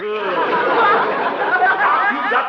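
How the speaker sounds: high, loud exasperated cry; exasperated groan. Real, not acted. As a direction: groan at him in frustration. [name] exasperated by Doc.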